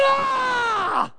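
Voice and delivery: high shouted ah of frustration; frustrated shout. Retold or imitated. Preacher acting a parent yelling at a kid, a shouted ah. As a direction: shout it out, fed up. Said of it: "and you're going".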